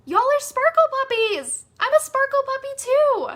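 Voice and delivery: high, loud, giddy exclamation; excited cheer. Real, not acted. Coach hyping her audience as sparkle puppies, excited cheer.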